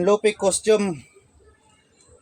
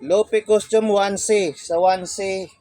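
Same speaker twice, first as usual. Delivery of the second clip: ordinary delivery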